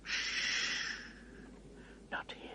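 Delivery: long shush, then soft whisper; hushed, secretive caution; urgent whisper. Acted. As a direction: whisper it guardedly. Beaver silences question about Tumnus.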